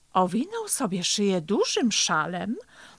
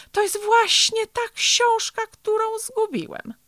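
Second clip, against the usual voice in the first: very high-pitched exclamation; emphatic, high-voiced reveal to a friend; excited revelation; 6 dB louder than usual. A narrator's reading. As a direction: exclaim it high, starting loud. Turtle reveals it's the lost book.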